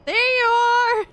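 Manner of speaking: high, loud, stretched-out hello to a pet; delighted greeting. Real, not acted. Owner spots the cat again, delighted. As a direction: sing it out on finding the cat.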